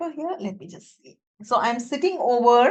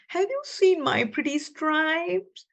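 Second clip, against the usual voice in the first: zebra puppet voice; 6 semitones higher than usual. Acted. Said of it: "she asked the lion".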